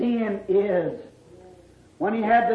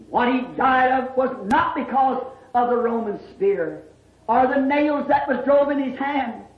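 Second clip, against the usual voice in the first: usual speaking voice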